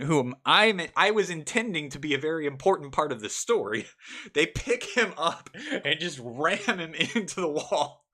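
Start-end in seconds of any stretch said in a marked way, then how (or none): 4.1-8.0 laughing through the words; can barely get it out for laughing. Real, not acted. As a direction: narration broken by laughter. Laughing while narrating.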